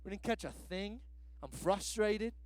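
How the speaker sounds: low, soft, fed-up grumble; tired fishermen answering a stranger, grumbling; grumpy tired fishermen. Retold or imitated. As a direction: mutter it like a worn-out fisherman. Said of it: "They're like".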